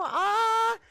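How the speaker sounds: high, loud delighted aww; gushing with joy at the name; excited. Real, not acted. Delighted greeting to chat.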